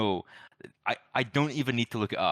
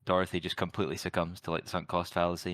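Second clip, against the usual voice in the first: usual speaking voice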